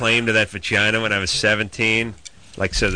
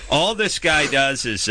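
ordinary delivery

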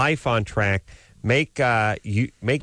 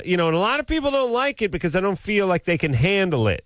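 blowhard voice; 10 semitones higher, faster than usual. Retold or imitated. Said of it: "I like this blowhard".

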